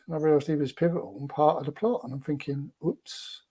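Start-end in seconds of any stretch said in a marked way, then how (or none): 2.9-3.4 whispered oops. Retold or imitated. Realising he'd upset the lead actor.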